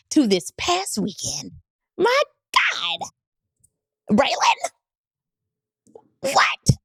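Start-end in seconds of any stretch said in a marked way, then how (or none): 2.0-6.8 high squeal falling into breathy, voiceless disbelief; hammed-up astonishment. Woman teasing last weekend's events to viewers, hammed-up astonishment. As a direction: gasp out each word separately, stunned. Stunned build-up to next episode.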